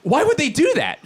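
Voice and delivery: spiral into frazzled disbelief. Real, not acted. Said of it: "See how fucking frazzled I am".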